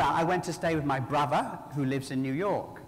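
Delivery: ordinary talk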